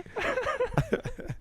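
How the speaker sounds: high delighted laugh. Real, not acted. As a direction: bursts out laughing. Laughing at the accent again.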